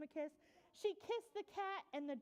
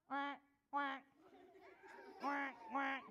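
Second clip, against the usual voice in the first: short, abrupt duck quacks; 3 semitones lower, slower than usual. Acted. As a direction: quack like a duck in a read-aloud.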